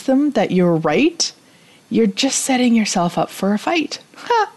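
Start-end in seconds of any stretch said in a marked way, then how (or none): 4.1-4.5 sudden laugh. Real, not acted. Sudden laugh at own rhyme.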